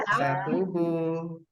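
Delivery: slow, drawn out, rising at the end; leading a drill. Shown as a demonstration. Trainer asks participants to follow along, leading a drill. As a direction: stretch it out for the group to echo. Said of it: "ikuti saya".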